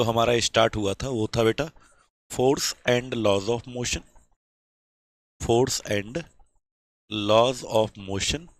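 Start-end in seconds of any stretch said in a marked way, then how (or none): 2.3-8.5 dictating slowly. Shown as a demonstration. Dictating chapter title slowly.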